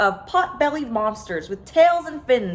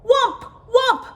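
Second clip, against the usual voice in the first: high, loud frightened cries; panicked cry; 12 semitones higher, 5 dB louder than usual. A narrator's reading. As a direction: call out in terror. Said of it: "they cried".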